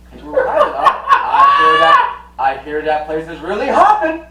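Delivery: high, loud gleeful shout; punning king. Retold or imitated. King delivering a cheesy pun, high and loud. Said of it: "He's like".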